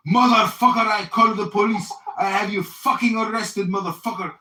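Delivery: loud, hammed-up threatening yell; mock furious buyer. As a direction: shout the threat like an angry buyer. Angry buyer threatening, retold.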